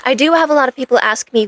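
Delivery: ordinary delivery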